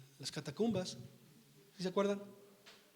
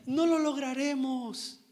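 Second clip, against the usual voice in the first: higher, slowed, fearful plea, lightly acted; frightened weeping woman; 6 semitones higher than usual. Runaway slave woman fearing capture in the boat, lightly acted fear. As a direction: voice a slave woman's mild mock panic. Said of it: "Y lloraba".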